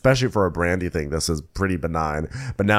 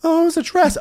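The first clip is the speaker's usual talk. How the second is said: much higher, prissy correction; snotty correction; 4 dB louder, faster than usual. Retold or imitated. Brandy correcting shirt to dress, high prissy voice. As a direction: correct her snootily.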